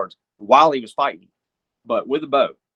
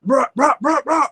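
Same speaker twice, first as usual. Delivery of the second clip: high buck grunts; 12 semitones higher than usual. Retold or imitated. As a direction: call out to stop the buck. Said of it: "And I'm like".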